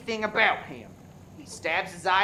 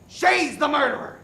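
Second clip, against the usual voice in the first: ordinary talk, nothing marked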